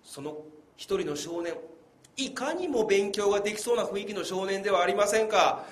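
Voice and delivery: normal talking voice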